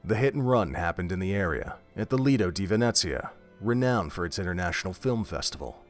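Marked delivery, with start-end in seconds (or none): none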